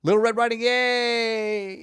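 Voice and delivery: bright excited chatbot voice; high cheerful voice. Retold or imitated. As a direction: squeal it like a thrilled child. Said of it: "Chat P T, who says".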